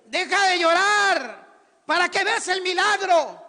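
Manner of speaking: loud, high, slow preaching; preaching climax. Real, not acted. Preacher giving the congregation the answer, loud and high. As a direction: proclaim it as the sermon's climax.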